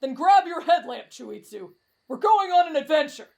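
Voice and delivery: high, loud call to adventure; rallying cry. Acted. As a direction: rouse your friends to set off. Camper rallies friends to adventure.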